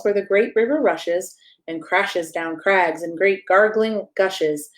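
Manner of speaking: ordinary delivery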